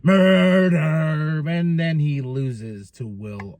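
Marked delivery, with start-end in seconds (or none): 0.0-1.4 slow, lightly voiced MJF menace; a hint of drawn-out wrestler threat. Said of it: "M J F says".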